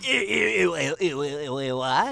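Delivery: put-on a quick, mild take on a confused old scientist; Professor Farnsworth. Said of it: "Professor Farnsworth might say".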